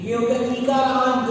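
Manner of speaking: usual speaking voice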